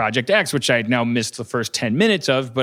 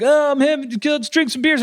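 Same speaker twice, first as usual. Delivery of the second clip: mock partygoers; 12 semitones higher than usual. Mocking the film's opening partiers in high voices. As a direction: play carefree found-footage kids.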